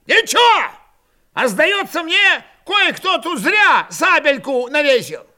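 put-on high, loud, quarrelsome old man; angry needling. A narrator's reading. Old Cossack taunts a comrade about his sabre, angry.

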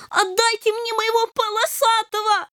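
a kitten blurting out straight; blurted kitten demand. A narrator's reading. Kitten blurts his demand to the king, high. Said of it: "напрямую ляпнул".

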